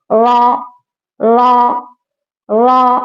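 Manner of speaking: रॉ said thick and full-mouthed; teacher sounds रॉ heavy. Shown as a demonstration. Teacher shows students a heavy रॉ. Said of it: "एकदम मोटा पढ़ना है इसे".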